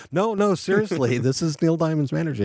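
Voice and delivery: high-pitched earnest protest; urgent insistence. Retold or imitated. Manager to a disbelieving bassist, urgently insistent. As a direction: jump up in pitch to stop a hang-up.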